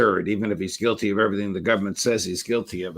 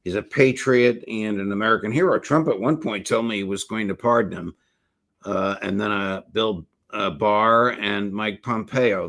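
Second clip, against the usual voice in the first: normal talking voice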